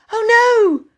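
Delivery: high, loud cry of dismay; agonized cry. A narrator's reading. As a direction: cry out in anguish. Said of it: "an agonized voice".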